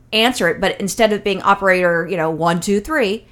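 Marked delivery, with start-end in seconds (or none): none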